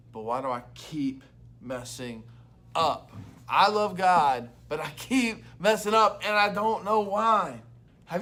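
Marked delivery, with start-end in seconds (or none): 3.5-7.6 louder, slower, pressing each word; emphatic loud restatement. Shown as a demonstration. Pastor repeating the week's question, louder and slower. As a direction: say it like a real confession you mean. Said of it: "repeats question with more force".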